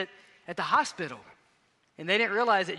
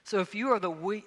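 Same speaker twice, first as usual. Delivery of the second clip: normal talking voice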